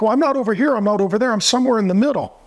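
high, quick mocking whine; mock fence-sitter. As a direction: parody the waffler, speeding up. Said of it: "You're like".

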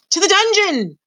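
high-pitched cry from a crowd; high, drawn-up shout. A narrator's reading. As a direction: cry out a condemnation. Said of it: "a voice cried out".

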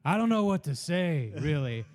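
lightly voiced movie-actor impression; Pacino. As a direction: put on a recorded actor's voice, dropping at the end. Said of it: "I hear Pacino's voice".

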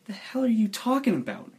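pitched high in disbelief; incredulous. Acted. Player disputes the friend's claim about the captain, incredulous. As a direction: challenge it, voice jumping up.

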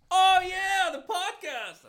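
very high, loud sarcastic drawl; mock him with exaggerated delight; mocking realization. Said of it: "He's like".

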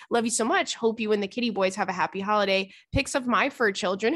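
usual speaking voice

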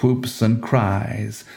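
usual speaking voice

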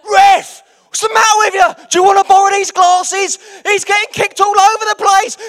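loud, high furious shout; dad yelling at the ref. Retold or imitated. Dad shouting at the referee over his kicked son, furious yell. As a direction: bellow at the referee from the touchline. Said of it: "my dad started having a go at the ref".

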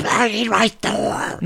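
high, slow hammed-up desperate shriek; desperate dad demanding the toy, shrieking; frothing mock rage. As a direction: rant like a crazed toy hunter. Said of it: "frothing at the lips".

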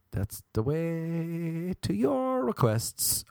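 high, slow, stretched-out vowels; playful drawn-out pitch. Pretend. Podcaster asking listeners to pay for requests, high and drawn out. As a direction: stretch it out like a jingle.

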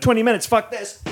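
high-pitched pretend huff; mock outburst. Guest acts the walkout he would never do, mock indignation. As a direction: play at storming out, pitch jumping up. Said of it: "I'm not gonna be like".

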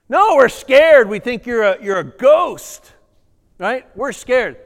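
half-hammed panicked plea; mock panic. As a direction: play the scared disciples. Voicing the frightened disciples.

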